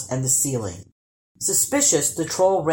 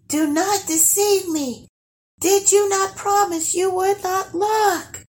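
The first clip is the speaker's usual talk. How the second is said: very high, sustained distant call; high held notes, as if from far away; voiced high warning, not a true whisper; faraway eerie voice. A narrator's reading. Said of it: "whispered Guri".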